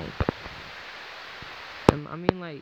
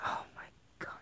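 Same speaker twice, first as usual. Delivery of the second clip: whispered; 21 dB quieter, faster than usual. Real, not acted. Disbelieving aside.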